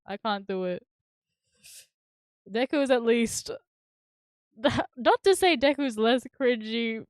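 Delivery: ordinary talk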